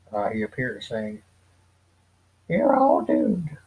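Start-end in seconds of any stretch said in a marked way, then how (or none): 2.5-3.6 slow, high, creepy warning voice; doom-laden old prophet, drawn out; ominous old man. Acted. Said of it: "If I can do a better one".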